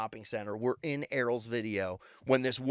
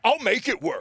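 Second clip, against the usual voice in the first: raised, higher-pitched angry retort; mock angry cop; 9 semitones higher, 6 dB louder than usual. Retelling a cop forcing friends through a turnstile, mock angry. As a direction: imitate a cop losing his temper. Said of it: "his anger won't let him be logical".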